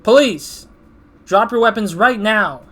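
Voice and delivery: loud, high command; police shout. Acted. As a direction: storm in yelling at them. Police burst in.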